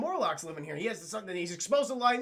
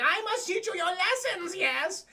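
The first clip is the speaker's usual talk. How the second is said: high, theatrical villain voice; shrill pompous sewer ruler; Sewer King voice; 10 semitones higher, slower than usual. Retold or imitated. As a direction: hiss it like a creepy cult leader. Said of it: "he's like".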